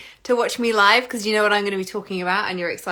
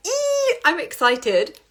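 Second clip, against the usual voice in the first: excited squeal; 9 semitones higher, 4 dB louder, slower than usual. Retold or imitated. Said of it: "part of it is like".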